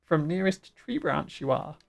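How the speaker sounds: swooping, squawky bird-creature voice. Acted. Bird chief making a deal, squawky. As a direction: bargain and scold as a bird chief. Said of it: "doing the bird voice".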